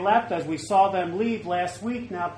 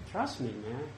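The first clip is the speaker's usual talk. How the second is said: soft, much quieter, gentle reassurance; still calm voice; 4 semitones lower, 8 dB quieter than usual. Retold or imitated. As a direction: hush it like a calm inner voice. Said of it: "in that s-, still calm voice say".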